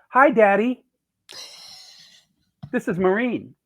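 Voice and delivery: high, sing-out phone greeting; daughter greeting her father. Retold or imitated. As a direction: imitate a daughter cheerfully phoning Daddy. Voices director phoning her father.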